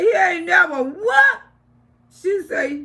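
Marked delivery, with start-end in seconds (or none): 0.0-1.4 loud, slow, high disbelieving cry; retold shock. To Shirley, who just confessed: a shocked, drawn-out what. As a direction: throw the words back in total disbelief.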